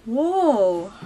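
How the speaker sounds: usual speaking voice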